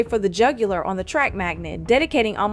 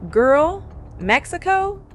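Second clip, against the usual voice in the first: disbelief; 7 semitones higher, slower than usual. Real, not acted. Disbelief at tagging Mexico.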